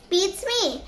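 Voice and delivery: plain reading voice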